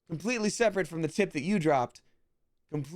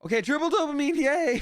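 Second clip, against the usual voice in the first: much higher, louder celebration; excited cheer; 12 semitones higher, 4 dB louder than usual. Real, not acted. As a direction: cheer as the triple loot lands. Celebrates a big loot drop.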